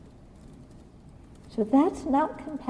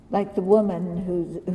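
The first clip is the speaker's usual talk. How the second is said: normal talking voice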